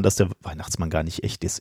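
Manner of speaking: hushed, low-volume voice; speaking quietly. Pretend. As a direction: keep it down so the kids sleep on. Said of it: "Ich muss leise sprechen".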